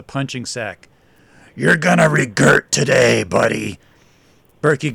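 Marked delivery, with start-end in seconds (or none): 1.5-3.8 gravelly threat. Acted. Tattooed attacker turns back to his victim, growling. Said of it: "in a gravelly voice".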